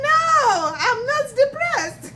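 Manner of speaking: mock protest. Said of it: "I'm like".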